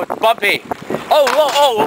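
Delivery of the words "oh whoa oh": high startled whoa; losing balance, yelping. Real, not acted. Wobbling on bike while filming.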